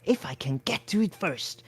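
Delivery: mental aside. A narrator's reading. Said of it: "he added, mentally".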